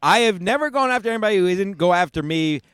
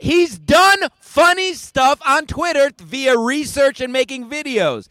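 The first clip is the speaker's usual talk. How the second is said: loud fast heated rant; heated pundit debate; 5 semitones higher, 4 dB louder than usual. Real, not acted. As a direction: push the point hard and loud.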